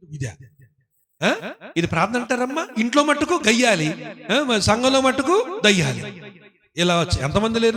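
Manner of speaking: ordinary delivery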